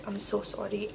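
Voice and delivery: ordinary talk, nothing marked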